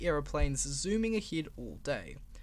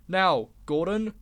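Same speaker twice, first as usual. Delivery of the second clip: stern call; 7 dB louder, slower than usual. Acted. Controller scolding Gordon.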